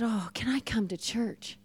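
hushed, awed whisper; woman asks softly, awed. Retold or imitated. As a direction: ask softly, in wonder. Hushed question after prayer.

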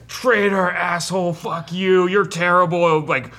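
high, loud angry mob of orcs; screaming jeers. Acted. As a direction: heckle and boo at the top of your voice. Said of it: "are just screaming".

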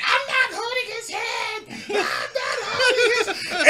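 high-pitched mock yell; high, hammed-up delivery-room wail; acted labour protest. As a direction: imitate a woman protesting mid-labour. Labouring woman's acted refusal.

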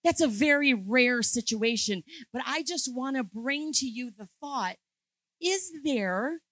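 rally the congregation with conviction; preaching at full force. Acted. Preaches at peak intensity.

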